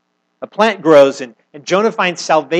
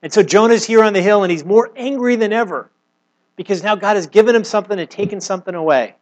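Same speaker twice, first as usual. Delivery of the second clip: rising fervour; 6 semitones higher than usual. Real, not acted. Preacher stirring the church over Jonah's anger, raised and fervent. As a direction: lift the congregation as the anger builds.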